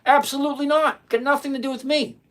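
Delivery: high, forceful refusal; emphatic denial. Real, not acted. Rejects viewer's political challenge.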